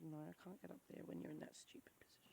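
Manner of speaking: quick grumpy whisper; mother whispers her daughter's grumble; whispered child's complaint. Retold or imitated. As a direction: whisper fast like a cross little girl. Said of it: "She's like".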